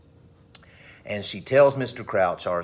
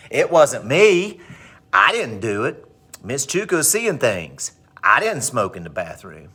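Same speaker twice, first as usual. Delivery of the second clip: loud, swooping indignant denial; hammed-up teenage back talk; sassy attitude; 5 dB louder than usual. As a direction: act the mouthy teen denying everything. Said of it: "cops the attitude and goes".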